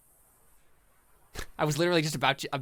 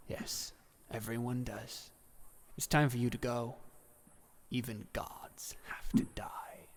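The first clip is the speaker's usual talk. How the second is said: whispery, final farewell; solemn Death; 4 dB quieter than usual. Acted. Voices Death.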